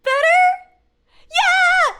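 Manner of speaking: loud, high-pitched cheer; excited shout. Real, not acted. Streamer thrilled her scare worked, excited yell.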